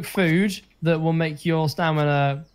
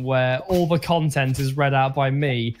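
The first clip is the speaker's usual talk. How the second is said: ordinary delivery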